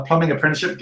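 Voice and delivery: ordinary talk, nothing marked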